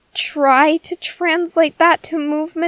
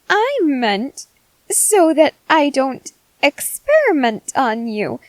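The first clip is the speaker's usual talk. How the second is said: ordinary delivery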